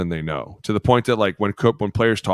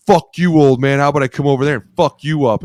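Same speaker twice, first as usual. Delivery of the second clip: higher and louder hammed-up threat; imitated shouted threat at the old coach; mock angry yell; 6 semitones higher, 7 dB louder than usual. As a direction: play the cocky young player picking a fight. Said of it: "And he was like".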